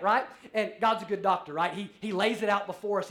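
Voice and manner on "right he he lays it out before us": usual speaking voice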